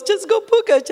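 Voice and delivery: high mock Richard voice saying pukać with a hard P; imitating husband. Wife mimicking Richard's slip, high and mock. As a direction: ham up her husband's mistaken word pukać. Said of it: "he goes".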